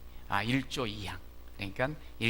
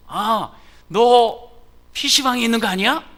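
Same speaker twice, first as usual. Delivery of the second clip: much higher, loud accusing mother; mother on the phone accusing her son, loud and high; suspicious mother; 10 semitones higher, 16 dB louder than usual. Retold or imitated. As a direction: catch the kid out, sharp and loud. Mother catches child at PC room.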